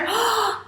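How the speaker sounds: quick high intake of breath; dramatic gasp. Pretend. Teacher reveals who was picked, gasping theatrically. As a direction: gasp as if amazed.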